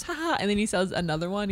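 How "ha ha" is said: high soft doll laugh; a toy's canned laugh; pull string toy. Retold or imitated. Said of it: "he goes".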